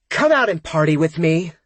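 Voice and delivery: high, loud, slurred begging; drunk pleading. A narrator's reading. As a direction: plead like a tipsy party girl. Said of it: "Nanette pleads into the phone".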